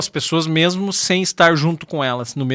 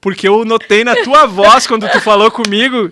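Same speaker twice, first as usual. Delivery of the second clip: ordinary delivery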